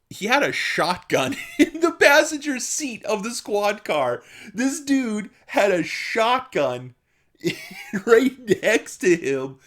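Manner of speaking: amused disbelief; laughing while telling. Real, not acted. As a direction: laugh through the shocking detail. Laughing about shotgun in car.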